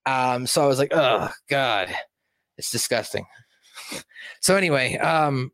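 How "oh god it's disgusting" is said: soft, grossed-out groan of disgust; quietly sickened. Retold or imitated. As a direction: let it sink away in revulsion. Reacting to the creepy scene.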